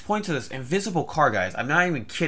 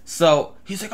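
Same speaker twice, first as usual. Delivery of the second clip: ordinary delivery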